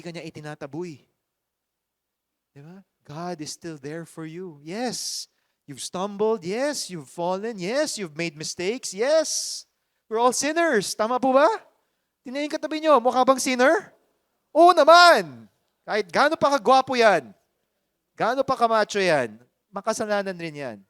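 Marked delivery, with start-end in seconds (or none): 4.6-11.0 loud, high preaching that rouses the hall; preaching at full force. Acted. Preacher rousing the congregation. 11.0-11.5 loud, high call to the crowd with a rising end; rousing question. Real, not acted. Asks congregation to agree. 12.2-20.8 loud, high preaching that rouses the hall; preaching at full force. Acted. Preacher rousing the congregation.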